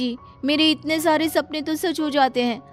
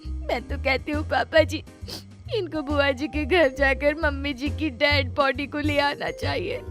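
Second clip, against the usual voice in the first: high, softer sniffling voice; tearful; 6 semitones higher, 5 dB quieter than usual. Acted. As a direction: suggest funeral plans through tears. बहू सास की मौत पर रोती. (रुआँसी आवाज़ में)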